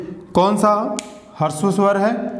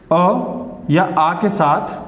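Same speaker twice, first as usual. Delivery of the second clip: अ या आ के साथ stretched very slowly; slow, drawn out; much slower than usual. Shown as a demonstration. Teacher to students, dwelling on the rule, slow and drawn. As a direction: spell it out word by word, slowly. Teacher drawing out key phrase.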